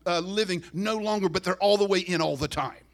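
loud, rising, impassioned intercession; fervent prayer. Acted. The pastor praying loud over the congregation. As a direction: pour it out like a preacher at full force. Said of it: "your pastor is praying".

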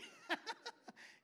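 high breathy laugh; real laugh. Real, not acted. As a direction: let the laugh out. Laughing at the memory.

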